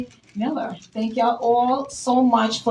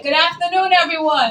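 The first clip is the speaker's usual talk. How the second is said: higher and a bit louder, pulling a bigger response; livelier greeting; 6 semitones higher, 4 dB louder than usual. Real, not acted. Speaker re-greets audience after a weak reply, energized. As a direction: wake the room up with it.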